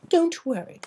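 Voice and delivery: a polite lady explaining gently; Rarity voice. Acted.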